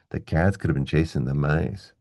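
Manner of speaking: fit "could have been" tightly between beats on "cats" and "chasing"; even pulse on "cats", "chasing", "mice", small words squeezed; regular stressed rhythm. Shown as a demonstration. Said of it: "keep a regular rhythm going".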